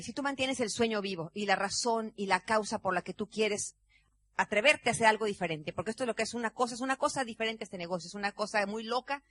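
ordinary talk

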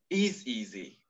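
normal talking voice

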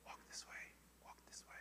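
tiny hushed whisper; God's whisper. Acted. God guiding the believer who trusts him, in a whisper. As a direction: whisper it, barely audible. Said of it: "when he just goes".

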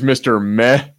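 ordinary delivery

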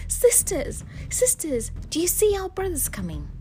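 high, anxious call without volume; high-pitched, urgent question from below. A narrator's reading. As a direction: call up to your sisters for help. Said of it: "called out to her sisters".

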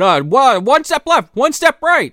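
a headless body being steered; high mock villain. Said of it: "he's directing the head".

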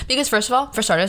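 ordinary talk

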